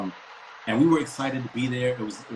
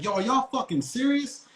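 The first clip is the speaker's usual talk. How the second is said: indignant friend; 10 semitones higher than usual. Retold or imitated. Friend Junior protesting to cops.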